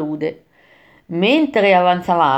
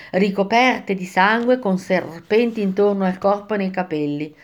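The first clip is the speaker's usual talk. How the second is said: plain reading voice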